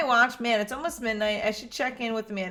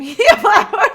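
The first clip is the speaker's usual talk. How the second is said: louder question breaking into laughter; laughing; 11 semitones higher, 14 dB louder than usual. Acted. Dad teasing daughter about social media, laughing. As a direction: crack up mid-question.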